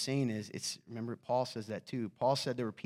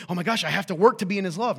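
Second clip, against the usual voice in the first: higher, louder, fast worried rush; mock panic; 5 semitones higher, 10 dB louder, faster than usual. Preacher voicing anxious believers who think love must be earned, in mock panic. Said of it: "you're gonna go".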